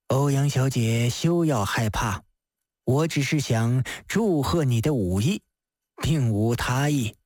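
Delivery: low, reassuring magistrate; calm her with a low, even voice; composed official, pitched well down; Di Renjie. Acted. Di Renjie reassures the frightened woman.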